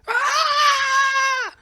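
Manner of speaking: long, very high scream; mock horrified scream. Comedian recalls his first blush impression, screaming in mock horror. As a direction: shriek at the thought, hammed up.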